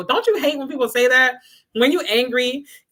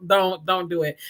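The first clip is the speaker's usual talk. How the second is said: ordinary delivery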